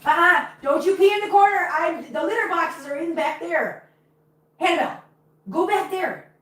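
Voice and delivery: high-pitched telling-off of a cat; scolding pet talk. Real, not acted. Scolding the cat about peeing.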